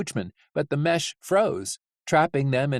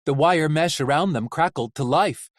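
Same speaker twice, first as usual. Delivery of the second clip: plain reading voice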